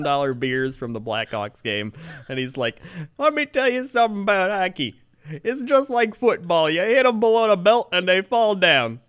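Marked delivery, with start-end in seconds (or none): 3.2-9.0 loud, high Chicago meatball voice; drunk Chicago fan. Retold or imitated. Fan at the Blackhawks game explaining hockey, loud Chicago accent. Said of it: "the hardcore Chicagoan people".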